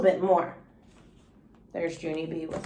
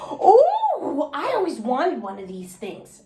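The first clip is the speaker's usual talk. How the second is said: thrilled holler; 4 semitones higher, slower than usual. Acted. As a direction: squeal with delight at the backpack. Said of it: "I hollered, real thrilled".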